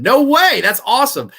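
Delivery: loud, high burst of delight; real thrill at the news; excited. Real, not acted. Reading a viewer's comment, bursting loud and high.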